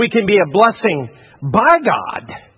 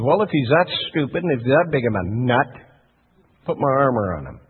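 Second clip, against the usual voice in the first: low, softer mock royal order; a hint of a dismissive king, pitched down; lightly voiced Saul; 7 semitones lower, 5 dB quieter than usual. Preacher as Saul sending David out, lightly voiced, dismissive.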